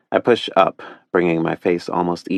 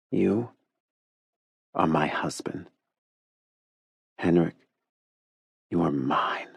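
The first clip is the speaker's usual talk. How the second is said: intimate hush; 5 dB quieter than usual. A narrator's reading. Intimate declaration to Henrik.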